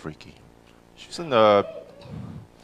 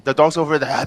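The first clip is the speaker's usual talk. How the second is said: ordinary delivery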